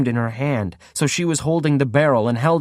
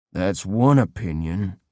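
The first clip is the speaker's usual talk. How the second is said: growl; 5 semitones lower, slower than usual. Acted. Captor mildly growling back at the girl. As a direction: lower and slower, a mild growl. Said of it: "Adair growled".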